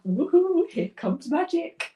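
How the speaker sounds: high gleeful whoop, slowing down; excited cheer. Retold or imitated. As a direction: cheer it like magic is happening. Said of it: "It's just like that".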